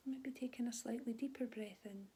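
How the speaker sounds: calm, unhurried body scan guidance with long pauses; soothing mindfulness teacher voice; guided meditation. Real, not acted. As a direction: gently guide them through their body, leaving space after each phrase.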